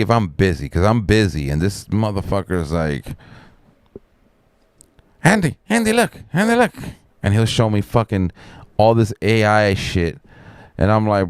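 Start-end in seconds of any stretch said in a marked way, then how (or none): 5.2-6.7 high, insistent calling; imitated pestering. Man pestering the busy speaker to look, high insistent mimicry. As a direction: nag for attention in a high mimicking voice.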